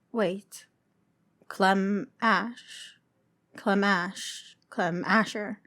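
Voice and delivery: soft, wondering murmur of discovery; hushed realization. A narrator's reading. Narrator voicing Clem piecing together her name, hushed wonder. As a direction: let it dawn on you, almost to yourself. Clem's sudden private realization.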